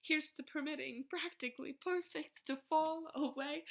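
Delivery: ordinary talk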